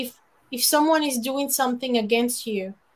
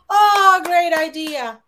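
much louder, higher fake delight; mock gushing praise; 6 semitones higher, 9 dB louder, much slower than usual. The boss praises the man for her idea, mock gushing. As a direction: gush over the idea like the clueless boss.

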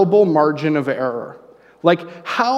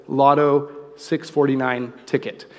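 slow, deliberate repeat; 2 semitones lower, slower than usual. Retold or imitated. Repeats order, she misheard.

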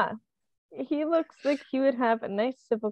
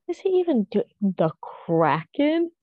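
ordinary talk

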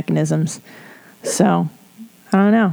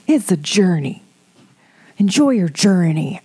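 put-on voice imitating empty encouragement; hammed-up, falsely uplifting cliché; mocking platitude. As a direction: parody a feel-good slogan before cracking up. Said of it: "when people say bullshit to you".

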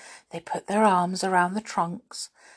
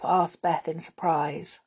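plain reading voice

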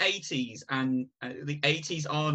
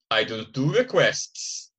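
Arnie quote; slower than usual. Retold or imitated. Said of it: "And Arnie goes".

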